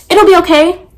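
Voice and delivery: high, loud brush-off; dismissive client. Retold or imitated. Client brushing off the nail tech's warning, high dismissive reassurance. Quoting client who won't hear it.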